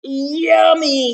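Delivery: loud, high, drawn-out delight; buzzed. Acted. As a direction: savour it like a greedy bee. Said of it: "he buzzed".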